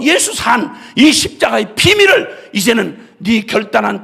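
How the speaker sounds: loud, fervent preaching; full-force preaching. Acted. Preacher pleading loudly with the congregation. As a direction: preach it out with all your strength.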